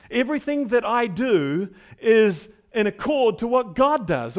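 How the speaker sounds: loud, building sermon delivery; rising pulpit intensity; full-force preaching. Acted. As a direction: preach it out to the congregation. Voicing Jesus, preaching force.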